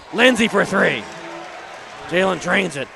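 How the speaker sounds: loud, punchy commentator shout; excited three-point call. Real, not acted. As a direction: belt out the made shot. Calling a made three-pointer.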